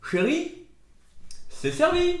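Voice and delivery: high, loud sing-out call to a partner; piercingly high dinner call. Pretend. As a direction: call your darling to the table. Calls partner to the table.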